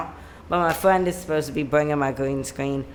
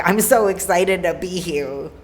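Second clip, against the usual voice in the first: a chirpy, higher mock-excited line; 3 semitones higher, 6 dB louder than usual. Mocking how her younger self sang it, mock excited. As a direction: parody a bubbly eager singer. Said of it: "excited ... voice".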